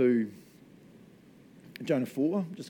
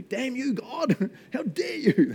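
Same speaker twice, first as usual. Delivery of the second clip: Jonah; 13 semitones higher, 4 dB louder, slower than usual. Acted. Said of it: "Jonah's like, and I say this carefully".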